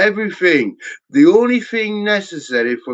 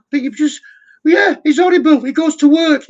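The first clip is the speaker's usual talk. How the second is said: mock police voice; 11 semitones higher, 4 dB louder than usual. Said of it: "fucking police are going".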